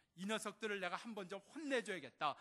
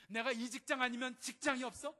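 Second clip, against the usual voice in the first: higher and louder, a fed-up worker ranting; angry outburst; 6 semitones higher, 6 dB louder than usual. Retold or imitated. Preacher acting out a worker who wants to quit, furious outburst. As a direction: blow up about quitting the job, voice raised high.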